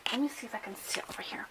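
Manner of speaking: fast whispered aside; whispering. Real, not acted. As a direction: whisper it quickly to yourself. Hushed aside while searching.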